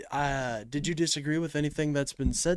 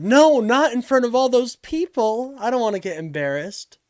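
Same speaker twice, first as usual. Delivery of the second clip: raised, louder imitation of a reluctant caller; plead, higher and louder, as the scared-off caller; mock scared protest; 8 semitones higher, 7 dB louder than usual. Streamer imitating a caller afraid of embarrassment, mock scared protest. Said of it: "He said".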